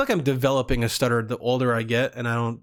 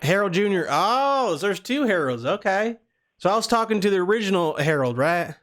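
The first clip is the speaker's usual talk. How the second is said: put-on Southern accent. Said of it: "we're doing the Southern accent".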